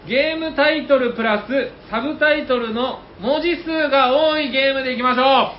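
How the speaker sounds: high, building play-by-play call; breathless rising goal commentary. Acted. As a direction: call the challenge like live sport. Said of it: "僕がちょっと実況っぽくするので".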